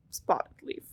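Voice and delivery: breathy ghostly whisper of the name; eerie dream whisper. Retold or imitated. Dream voice of dead cat.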